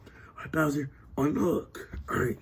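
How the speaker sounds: low secret whisper. Acted. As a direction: whisper it so guards won't hear. Secret escape instruction.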